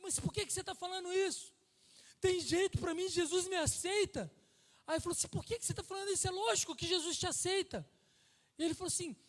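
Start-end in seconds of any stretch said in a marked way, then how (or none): 0.0-7.8 loud, higher insistence; emphatic reassurance. Retold or imitated. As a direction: insist firmly that Jesus accepts him. Pastor's reassuring retort retold.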